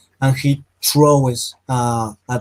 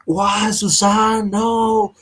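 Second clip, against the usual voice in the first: high, drawn-out wail at no great volume; mock scream; 8 semitones higher, much slower than usual. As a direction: play a despairing scream, held back in volume. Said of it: "So I scream like".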